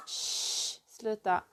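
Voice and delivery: soft shush and hushed Swedish sluta; hushed aside to the dog; hushing the dog. Real, not acted. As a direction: quietly tell the dog to stop. Hushing the dog mid-talk.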